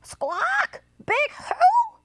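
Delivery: very high, piercing parrot squawk; screechy storybook parrot. A narrator's reading. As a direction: squawk the question like a startled parrot. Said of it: "said the parrot".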